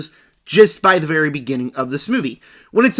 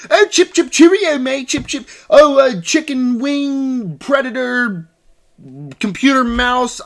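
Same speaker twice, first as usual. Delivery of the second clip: high, hammed-up British gibberish voice; silly British accent; 6 semitones higher, 3 dB louder than usual. Reviewer mocking the actress's random outbursts, silly British accent. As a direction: spout nonsense in a mock British accent. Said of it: "talking in this stupid-ass British accent".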